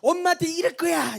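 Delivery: high-pitched imitation of a shouting kid; child's yelled threat. Preacher re-enacting the child's threat to tell Mom, yelling. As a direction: shout it as a tattling child would. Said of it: "이렇게 외치는 거예요".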